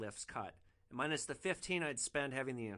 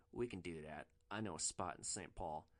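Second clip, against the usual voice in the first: lower, softer put-on dude voice; laid-back guy with a plan; low casual voice; 8 semitones lower, 8 dB quieter than usual. Rider reassuring the hooker, low casual voice.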